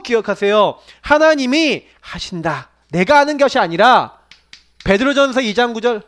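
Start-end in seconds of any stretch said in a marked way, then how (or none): none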